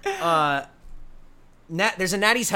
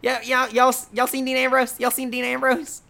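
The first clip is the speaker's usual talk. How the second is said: lightly higher Southern accent impression; a teasing Southern accent, mimicking a wrestler; higher Southern accent asking y'all around backstage; Jamie Noble Southern accent; 6 semitones higher, 3 dB louder than usual. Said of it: "his Southern accent and his goatee going".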